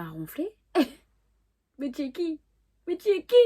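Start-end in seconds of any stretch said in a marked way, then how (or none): 1.8-3.3 high-pitched indignant challenge; mock outrage. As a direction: challenge them, pitch climbing on the repeat. Outrée qu'il se mette à ronfler. (indignation jouée, voix haut perchée)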